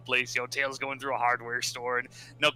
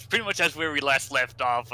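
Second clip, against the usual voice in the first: normal talking voice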